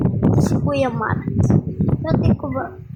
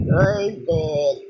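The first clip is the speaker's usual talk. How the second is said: much lower, altered voice; changed voice; 12 semitones lower, 3 dB quieter than usual. Real, not acted. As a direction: sounds like a deep voice changer. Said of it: "Ba't yun yung boses?".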